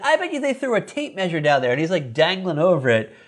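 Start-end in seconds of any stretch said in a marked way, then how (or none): 0.0-1.7 put-on higher, fast kid chatter; Bobby voiced. Bobby guessing while dangling over the railing, higher fast kid voice. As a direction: rattle it off like an excited boy.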